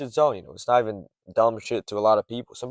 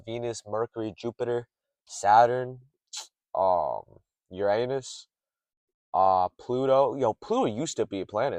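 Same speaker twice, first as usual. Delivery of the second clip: usual speaking voice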